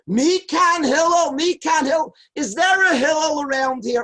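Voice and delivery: high, loud yelling for Hillel down the street; screaming in the street. Retold or imitated. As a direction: bellow it out, calling for Hillel. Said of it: "he screams in the street".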